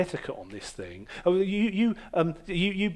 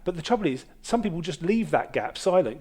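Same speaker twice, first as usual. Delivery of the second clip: ordinary delivery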